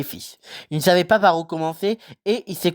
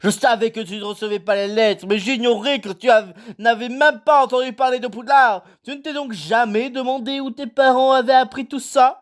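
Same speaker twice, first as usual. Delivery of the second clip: Hagrid voice; 7 semitones higher than usual. A narrator's reading. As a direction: play the kindly giant, unhurried. Hagrid voice held.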